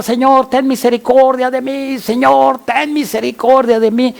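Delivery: high-pitched pleading call; urgent acted cry. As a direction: call out like the blind beggar begging mercy. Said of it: "que gritó".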